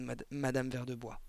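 plain reading voice

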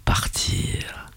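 very low, fading to a breath; a heavy beast trailing off; a deep cavernous rasp; low creature rumble. A narrator's reading. Creature continues its growl.

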